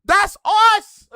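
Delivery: loud, high-pitched insistence; emphatic outburst. Real, not acted. Streamer insisting the clip is Toronto talk, exasperated and loud. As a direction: burst out in exasperated vindication.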